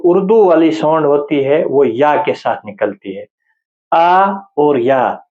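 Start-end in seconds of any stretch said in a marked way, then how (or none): 3.9-5.2 آ and یا drawn out and slow; slow demonstration of the two vowel sounds. Shown as a demonstration. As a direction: spell out آ then یا, slowly. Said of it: "یہ خاص خیال رکھیں آپ".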